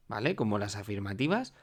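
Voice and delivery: ordinary talk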